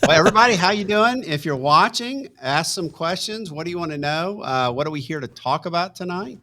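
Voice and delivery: upbeat, high host greeting; show host welcome. Acted.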